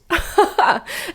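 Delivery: high burst of laughter. Real, not acted. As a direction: laugh in a quick high peal. Host laughs at own jab.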